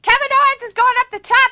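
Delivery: excited play-by-play call. Real, not acted. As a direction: call the big climb with excitement.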